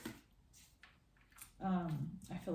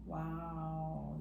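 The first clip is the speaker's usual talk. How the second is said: ordinary talk, nothing marked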